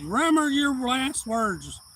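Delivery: slow, menacing, high and loud; a slow yelled warning before a fight; retold threat. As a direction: drag out each word as a warning. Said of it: "I said".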